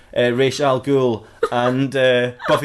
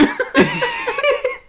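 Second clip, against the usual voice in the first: very high squealing laughter; shrieking high laugh. Real, not acted. Storyteller breaking down at Giles scene, shrieking laugh. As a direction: lose it completely, squeal with laughter.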